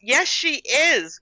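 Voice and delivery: high, slow, loud insistence; crying out. A narrator's reading. Gertrude insists Daisy will keep them, crying out. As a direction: insist like an upset little girl. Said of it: "Gertrude cried".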